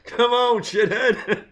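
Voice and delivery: high, loud call to a dog, laughing. Retold or imitated. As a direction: call Shithead like calling a dog in. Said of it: "Steve Martin's dog in The Jerk".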